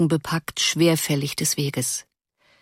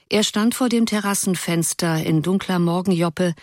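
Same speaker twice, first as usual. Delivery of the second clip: plain reading voice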